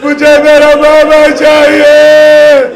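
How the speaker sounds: high, loud, very slow drawn-out wail; child's keening wail. Acted. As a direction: stretch every word like a sobbing child. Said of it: "رو کے کہتی ہے".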